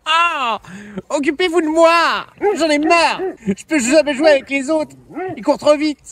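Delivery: high, mock-plaintive whine; high-pitched play-acted tantrum; complaining dog's voice. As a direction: whine like an attention-starved dog.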